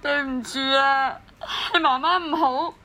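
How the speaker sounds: tearful self-blame; overcome, crying mother's apology; crying uncontrollably. Acted. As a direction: apologise to the baby through sobs. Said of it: "喺我喊到收唔到聲嗰陣".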